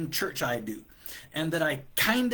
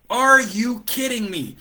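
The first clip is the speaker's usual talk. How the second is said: loud, high, slow outburst of disbelief; outraged disbelief; 7 semitones higher, 10 dB louder, slower than usual. Real, not acted. Coach fed up with a stranger's request, loud disbelief. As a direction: blurt it out, truly fed up.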